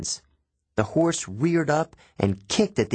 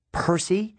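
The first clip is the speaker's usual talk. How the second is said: higher-pitched call, not full volume; restrained shout; 7 semitones higher than usual. A narrator's reading. Mother calls her son over the rain, mild shout. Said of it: "shouting to be heard over the rain".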